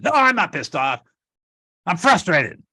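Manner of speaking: loud, high, acted angry protest; ratcheted-up frustration. Upset partner rejecting the label, acted ratcheted-up frustration. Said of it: "Now she's echoed up, right? She j-, ratcheted up".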